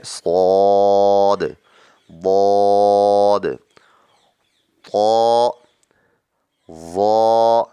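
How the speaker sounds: long, loud heavy letters. Shown as a demonstration. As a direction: fill the mouth on each. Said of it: "یہ بھی حروف مستعلیہ میں ہیں".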